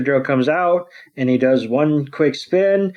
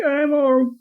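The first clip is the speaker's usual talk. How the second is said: squeaky high little creature voice, hesitant; high Axew voice; 10 semitones higher than usual. Retold or imitated. Retelling the episode, Axew answers Iris in a high voice. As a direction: voice the small dragon high and unsure.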